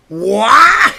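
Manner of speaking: a high, loud, startled "What?"; shocked shout. Acted. Hero sees he's a Pokemon, shouting in shock. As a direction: yell in disbelief at your reflection.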